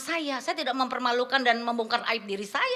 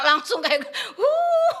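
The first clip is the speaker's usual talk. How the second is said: sobbing laugh; 9 semitones higher, 7 dB louder, much slower than usual. Real, not acted. Breaking down recalling.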